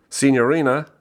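called out high and loud across the cafe; calling out. A narrator's reading. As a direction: raise the voice to summon the waitress. Johnson calls the waitress over.

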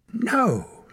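high-pitched cry of protest; shouted. A narrator's reading. Boy protests being tied up, high shout. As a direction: cry out against it, pitch shooting up. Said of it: "shouted Torak".

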